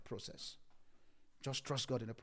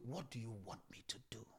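whisper; 4 semitones lower, 11 dB quieter than usual. Acted. Voicing a prayer to God.

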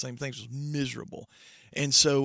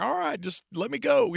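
higher and louder, imitating an awkward dismissal; mock brush-off; 10 semitones higher, 6 dB louder than usual. Imitating Clay brushing him off on the stairs, mock uneasy. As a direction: play the baffled friend edging away. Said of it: "He's like".

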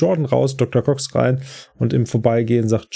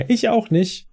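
eager JD chiming in; 10 semitones higher, slower than usual. Retold or imitated. JD chimes in from behind.

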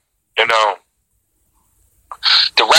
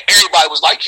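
ordinary talk, nothing marked

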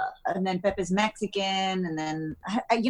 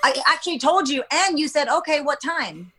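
higher-pitched, insistent mock exasperation; retold exasperated reminder; 7 semitones higher than usual. Wife reminding husband who doesn't listen, exasperated. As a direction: remind him, as if retelling a tiff. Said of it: "And I'm like".

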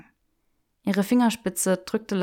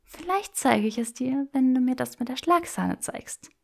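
put-on higher, playful young woman; teasing Hermione voice; 6 semitones higher than usual. Granger teasing Draco in the bookshop, playful and higher. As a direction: tease him with mock refusal.

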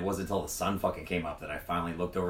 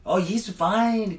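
gang reassuring; 10 semitones higher, 5 dB louder, much slower than usual. Retold or imitated. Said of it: "the Mexicans were like".

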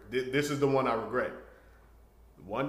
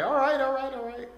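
higher, hurried woman's voice; her hurried voice; 13 semitones higher, 4 dB louder than usual. Retold or imitated. Woman answering his demand in a higher hurried voice. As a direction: rush out a nervous okay as her.

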